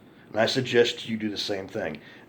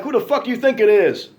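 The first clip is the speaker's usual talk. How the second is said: louder, higher furious retort; angry retort; 10 semitones higher, 7 dB louder than usual. Retold or imitated. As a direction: bark it back at him, outraged. Confronting the guy.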